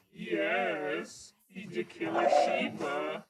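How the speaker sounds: ordinary delivery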